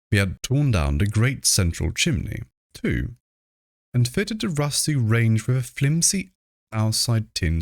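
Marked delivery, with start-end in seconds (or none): none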